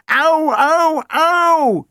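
a squeaky, hammed-up cry of pain; groaning. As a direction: groan like a dented spoon. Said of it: "groaned the spoon".

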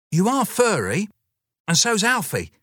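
put-on high, earnest child; little girl. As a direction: point it out like a small girl.

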